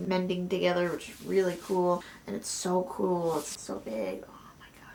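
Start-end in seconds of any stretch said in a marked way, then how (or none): 3.4-4.2 hushed, awed whisper, much softer. Real, not acted. Whispering over the book. 4.3-4.9 awed whisper, almost silent. Real, not acted. Whispered reaction to book.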